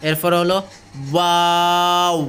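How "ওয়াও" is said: ওয়াও drawn out, closing to round lips; fully rounded lips. Shown as a demonstration. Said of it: "ঠোঁটটায় পুরো গোল করতে চেষ্টা করবেন".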